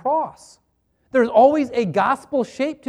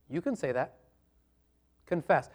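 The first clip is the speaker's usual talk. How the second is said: ordinary talk, nothing marked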